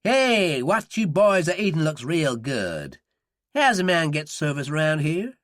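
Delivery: friendly American Southern drawl, slow; genial southern accent. Acted. Dillon greets the young men at the bar in a genial Southern accent. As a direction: play a folksy Southern Yank. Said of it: "assumed his version of a southern accent and said genially".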